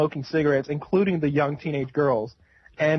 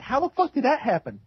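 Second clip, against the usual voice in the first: shocked question; 9 semitones higher than usual. Retold or imitated. Own shocked reply.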